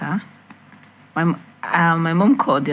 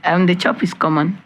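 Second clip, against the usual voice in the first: normal talking voice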